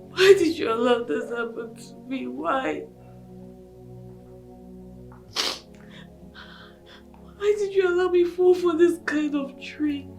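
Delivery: soft, tearful lament; crying. Acted. Bride asking God why she was tricked, crying. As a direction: plead quietly through tears. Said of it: "why are you crying?".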